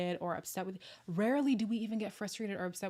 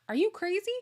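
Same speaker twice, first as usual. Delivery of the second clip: put-on high, louder childish question; quoting her younger self; 11 semitones higher, 5 dB louder than usual. Retelling what she said to her dad, a higher quote. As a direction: toss it off lightly to dad. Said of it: "I said to my dad".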